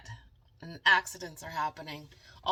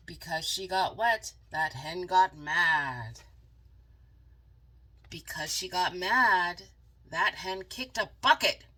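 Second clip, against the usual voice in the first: louder, building angry telling; mad hen; 8 dB louder than usual. A narrator's reading. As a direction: push it out like the hen is fuming. Hen gets angry in story.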